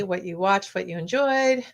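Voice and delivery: ordinary delivery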